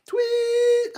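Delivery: high loud tweet whistle sound. Shown as a demonstration. Showing kids the whistle's sound, high tweet. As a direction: squeak it out like a whistle. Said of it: "See his whistle going".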